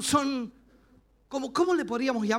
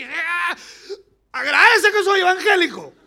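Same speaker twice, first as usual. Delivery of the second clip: loud, high hammed-up yell; mock angry threat; 7 semitones higher, 10 dB louder than usual. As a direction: act out yelling out of the car window. Grita enojado por la ventana. (grito de enojo exagerado)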